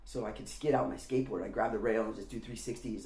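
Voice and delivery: normal talking voice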